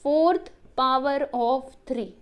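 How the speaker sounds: fourth power of three drawn out, every word stretched; very slow repeat for pupils. Shown as a demonstration. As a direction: repeat it very slowly so children copy it. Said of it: "तो क्या बोल सकते हो बच्चों".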